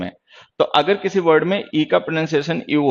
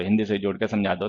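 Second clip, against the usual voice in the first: lazy inner voice again; 6 semitones lower, 6 dB quieter than usual. Retold or imitated. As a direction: lower and softer, repeated with a stress on Hindi. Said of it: "मेरे अंदर आलस आएगा".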